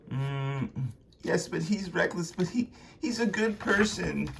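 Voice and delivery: put-on high, hesitant young voice; pleading defence. Luna defends Konstantin meekly.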